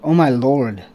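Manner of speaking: ordinary talk, nothing marked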